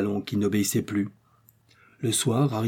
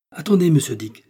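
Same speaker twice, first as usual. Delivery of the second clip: raised, louder plea to wait; urgent call; 5 semitones higher, 5 dB louder than usual. A narrator's reading. Joe stops the hunter sacrificing weapons, calling out. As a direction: call out to stop him.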